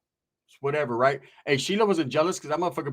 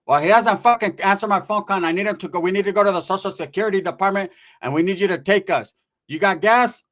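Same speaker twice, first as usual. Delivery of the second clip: higher, louder fed-up aunt voice; acted-out indignant auntie; irate aunt; 4 semitones higher, 6 dB louder than usual. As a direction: demand it like a cross tia on the phone. Tia complaining about Ricky on phone.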